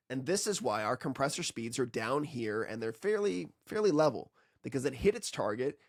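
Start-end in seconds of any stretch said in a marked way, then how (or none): none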